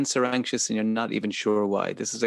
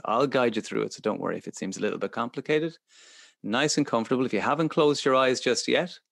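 ordinary talk, nothing marked

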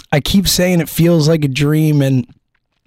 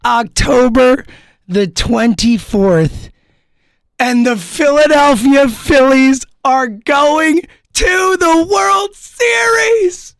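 hoarse, higher excited shouting; hoarse cry; 9 semitones higher than usual. Real, not acted. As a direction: yell it out with a voice worn raw. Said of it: "I have no voice left".

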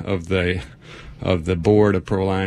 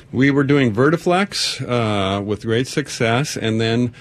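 ordinary talk, nothing marked

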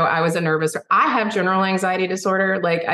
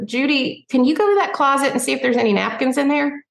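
higher, lightly voiced Dolly calling Judy; imitating Dolly; 6 semitones higher than usual. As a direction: call sweetly across the room as Dolly. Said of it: "I hear Dolly go".

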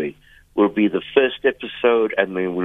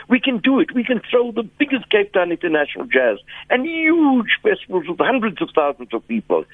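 rallying; 11 semitones higher than usual. Real, not acted. Rallying the industry, pitch jumps.

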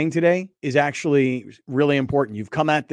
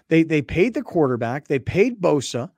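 ordinary talk

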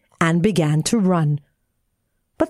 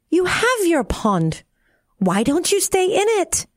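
put-on high-pitched child's reproach; Tearful; 10 semitones higher than usual. A narrator's reading. Tearful to the frog in her pond, high reproach. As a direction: pitch it up as an aggrieved little girl. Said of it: "said Tearful".

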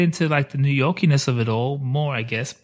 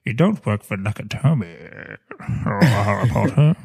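lightly voiced Rickman villain; Snape voice; 5 semitones lower than usual. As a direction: sneer it like Snape. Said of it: "Alan Rickman impersonating".

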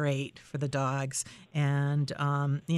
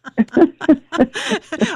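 loud laugh; 12 semitones higher, 11 dB louder than usual. Real, not acted. Host laughs at own mistake.

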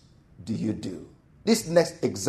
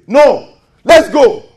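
loud, slow, urgent no; hurried impatient call to chase; impatient shout; 10 semitones higher, 11 dB louder, slower than usual. Retold or imitated. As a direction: call them to go now, loud. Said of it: "won't you say that?".

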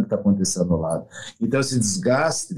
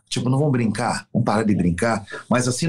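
normal talking voice

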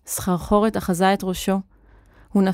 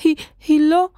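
high, upset faltering protest; distraught sniffly objection; tearful stammer; 7 semitones higher, 4 dB louder, faster than usual. A narrator's reading. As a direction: stumble on it, close to tears. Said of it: "משך נוח באפו".

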